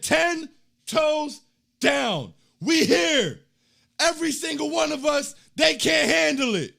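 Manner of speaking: rally the fans, slow and loud; hype shout. Retold or imitated. Reading hype chat comment aloud.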